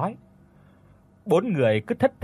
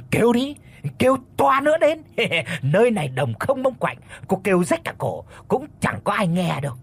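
menacing sneer; 7 semitones higher than usual. A narrator's reading. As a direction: gloat over the helpless victim, pitch raised. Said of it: "còn cười gằn lên mà nói".

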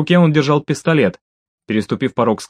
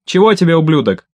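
higher, louder insulting demand; narrated shout with raised pitch; shouted retort; 5 semitones higher, 4 dB louder than usual. A narrator's reading. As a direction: call back roughly at an enemy. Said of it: "крикнул Андрей".

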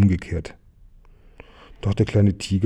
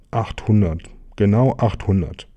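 ordinary delivery, nothing marked

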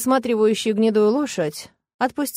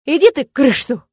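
loud burst of swearing, a bit higher; blurted curse; 4 semitones higher, 7 dB louder than usual. A narrator's reading. Nastya blurts a curse at Bran and the smith. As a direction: spit it out angrily. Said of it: "выпалила я".

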